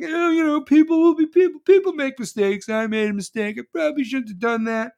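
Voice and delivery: high, loud, whiny excuse voice; hammed-up sheepish politician; mock excuse. Mocking people who shrug off the rule-breaking, in a high whiny voice. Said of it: "everyone's just like".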